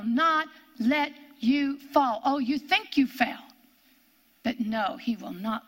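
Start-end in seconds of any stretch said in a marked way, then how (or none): none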